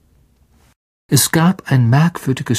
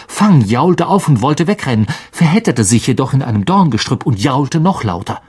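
ordinary delivery, nothing marked

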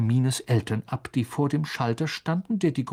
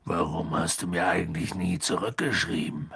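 low rumbling giant voice; Hagrid asking; 7 semitones lower than usual. A narrator's reading. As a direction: ask it deep in a put-on giant's voice. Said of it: "fragte Hagrid".

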